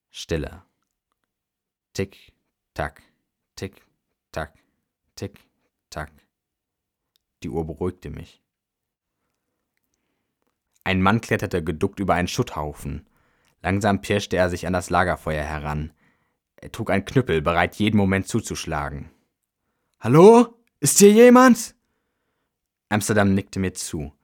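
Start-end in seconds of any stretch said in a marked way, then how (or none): 1.9-6.1 small soft ticks at a steady beat; tick-tock quietly, each syllable on its own; hushed, mechanical watch sound; imitated clock ticking. A narrator's reading. Narrator imitates the pocket watch. 20.0-21.7 high, loud searching shout; raised voice, rising questioning call. A narrator's reading. Story man calls into the ruins.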